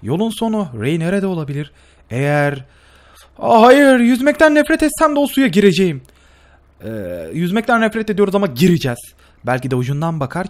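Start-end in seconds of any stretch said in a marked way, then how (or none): 3.4-6.0 loud, high groan of dismay; loud protest. Real, not acted. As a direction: cry out, I hate swimming. Suya girmek zorunda kalınca. (yüksek sesle isyan)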